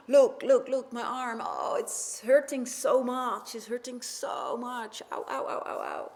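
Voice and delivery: high, urgent cries of pain; acted king wailing over his hurt arm; pained cry. King in pain calls counselor.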